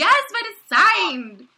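high, loud, slow gleeful boast; excited. Real, not acted. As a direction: show it off with glee. Boasting about signed book.

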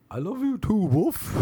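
very high goofy dog voice. Retold or imitated. Dog answering its doting owner, high silly dog voice. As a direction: play the adoring pet, squeaky high with a woof. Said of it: "She did a dog's voice back".

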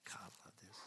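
breathy whispered aside, very soft; a whispered private delight mid-sermon. Real, not acted.

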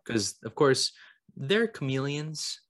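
usual speaking voice

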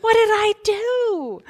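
very high, mock-alarmed squeal; hammed-up panicky question; dismayed mom. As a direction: stretch it out high in comic dismay. Acting out mom's dismay.

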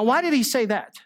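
normal talking voice

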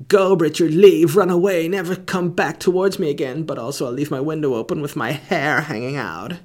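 hammed-up old Hollywood lover; 1950s Hollywood romance. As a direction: play an old movie heroine sending him away. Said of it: "nineteen fifties Hollywood love story".

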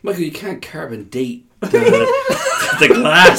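talking through real laughter; laughter bursting into the last words; laughing. Real, not acted. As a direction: crack up halfway through the sentence. Cracking up at table-age question.

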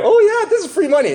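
gleeful clueless borrower, laughing; naive debtor. Retold or imitated. Debtor with no idea how Visa cards work, high and gleeful.

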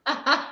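short light laugh; brief amused chuckle. Real, not acted. Laughs at the name joke.

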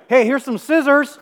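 called out high and loud, slowly; calling out. Retold or imitated. Brother-in-law calls after him, loud and slow. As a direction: shout it after someone walking away.

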